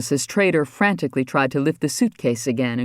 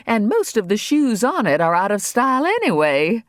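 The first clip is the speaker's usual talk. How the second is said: higher, chattering cover story; First Lady voice; 7 semitones higher than usual. A narrator's reading. The First Lady invents a story for the governor, higher and quick. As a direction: babble a quick excuse, pitched up.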